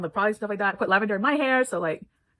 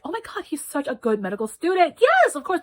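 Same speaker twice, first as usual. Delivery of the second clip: gushing praise, higher pitch, leaping on the yes; delighted teacher; 7 semitones higher, 3 dB louder than usual. Retold or imitated. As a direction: gush like a thrilled mentor. Acting out delighted teacher praising student.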